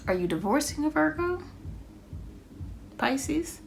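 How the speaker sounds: high, rising surprised ask; high, curious question with a rise at the end. Real, not acted. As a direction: blurt it out as a sudden hunch, pitch jumping high. Reader blurts a sudden guess.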